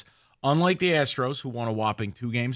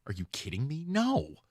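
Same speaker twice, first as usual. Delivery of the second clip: retold incredulity; 2 semitones higher, 7 dB quieter than usual. Retold coach's incredulous answer.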